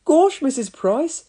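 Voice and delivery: high, slow startled gasp; startled cook after a fall. A narrator's reading. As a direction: catch your breath in surprise. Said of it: "gasped Elvis".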